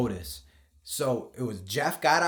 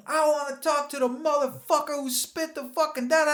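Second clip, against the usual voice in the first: high mock voice of an angry woman; 14 semitones higher than usual. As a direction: imitate a furious woman demanding answers. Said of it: "She's like".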